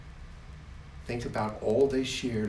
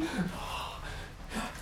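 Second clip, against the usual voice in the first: excited gasp; 14 semitones higher, 7 dB quieter than usual. Acted. God gasping with joy.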